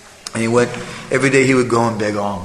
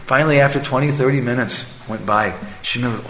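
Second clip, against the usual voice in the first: ordinary delivery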